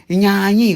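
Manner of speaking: "nhanhi" said high and loud, each syllable clear. Shown as a demonstration. King demonstrating how some say it, high and loud. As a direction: call out "nhanhi" as one regional variant. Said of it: "outros vão falar".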